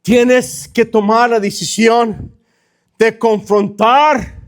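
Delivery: very slow, deliberate charge, leaping high at the end; emphatic preaching. Real, not acted. Pastor charging listener to confront sins, slow emphatic preaching. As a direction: draw out each phrase, then cry out the last.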